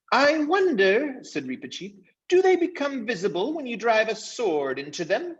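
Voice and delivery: a tiny eager swordsman; valiant mouse Reepicheep. Acted. Reepicheep eager to fight the invisible foes, very high.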